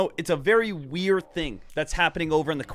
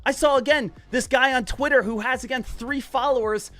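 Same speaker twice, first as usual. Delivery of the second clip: naive meme holder; 6 semitones higher than usual. Retold or imitated.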